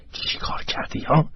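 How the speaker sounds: anxious whispered question. A narrator's reading. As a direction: whisper it worriedly to your son. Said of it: "با اضطراب از ایگنشس پرسید".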